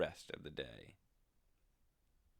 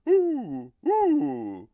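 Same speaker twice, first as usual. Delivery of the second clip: very high, much louder ghostly owl hoots; owl's spooky hoot; 13 semitones higher, 16 dB louder than usual. Acted. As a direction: boom out a spooky owl cry. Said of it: "flapped his wings and shouted".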